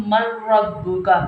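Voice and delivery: "मर रब्बुका" with doubled r and no n; noon merged into ra. Shown as a demonstration. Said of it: "मैं फिर कह रही हूँ".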